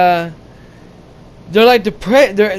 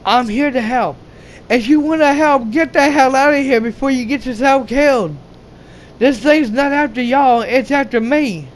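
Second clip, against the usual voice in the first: higher, put-on female voice; imitating the girl; 4 semitones higher than usual. Girl finding the men where it is unsafe, higher put-on voice. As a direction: voice the scolding girl a bit higher. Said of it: "And she's like".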